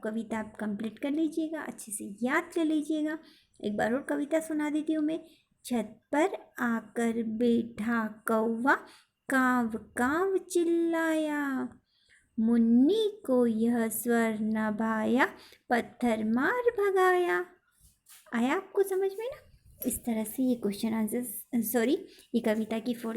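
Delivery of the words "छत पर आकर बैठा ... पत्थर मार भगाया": put-on slow, sing-song reading of a children's poem; poem recitation. Shown as a demonstration. Teacher reciting the poem to her pupils, slow and measured. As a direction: recite each line slowly for the class to copy.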